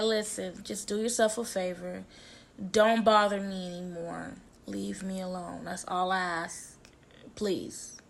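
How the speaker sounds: soft, low, restrained plea; asking nicely. Retold or imitated. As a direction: retell a polite request, keeping it quiet and low. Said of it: "that's as nice as I was gonna get".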